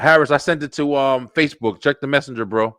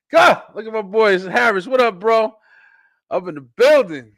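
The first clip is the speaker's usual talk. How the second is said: louder, higher hype welcome; excited greeting; 11 semitones higher, 8 dB louder than usual. Real, not acted. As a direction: hype up the friend joining the stream. Greeting a guest joining.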